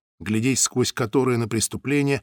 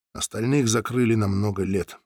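ordinary delivery

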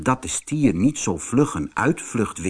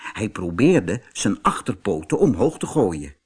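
ordinary delivery, nothing marked

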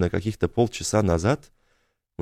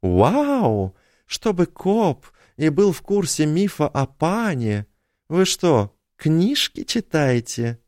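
put-on higher, slow teasing girl voice; cheeky teen girl; 8 semitones higher, slower than usual. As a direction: tease the cop like a cheeky girl.

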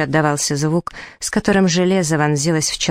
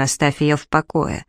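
ordinary delivery, nothing marked

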